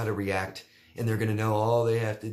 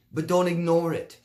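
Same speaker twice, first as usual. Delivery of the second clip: higher and louder, pressing the point; emphatic warning; 8 semitones higher, 7 dB louder than usual. Real, not acted. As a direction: make sure she really hears it. Urgent advice to listener.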